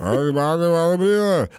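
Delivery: slurred giant line; lumbering giant read; Andre the Giant voice. Retold or imitated. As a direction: mumble it like a big gentle giant. Repeating the Andre impression.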